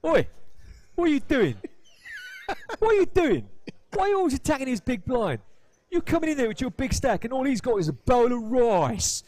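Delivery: loud, high Cockney telling-off; hammed-up Cockney heckle, pitched right up; Cockney accent. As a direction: mimic an East End Cockney having a go. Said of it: "But I said it like that".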